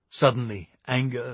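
usual speaking voice

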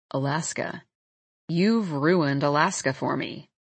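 low-pitched, understated playful complaint. A narrator's reading. Man telling Calla why he came, mildly playful. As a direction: tease lightly in a low man's voice. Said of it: "His tone is playful".

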